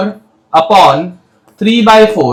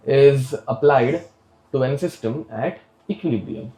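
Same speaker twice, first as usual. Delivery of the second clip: drawn-out dictation, repeating each phrase; slow dictation; 5 semitones lower, 7 dB quieter, much slower than usual. Acted. Teacher dictating the definition to the class, slow and repeated. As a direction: dictate slowly for students to write.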